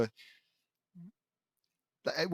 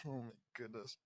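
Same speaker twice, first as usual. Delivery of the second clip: usual speaking voice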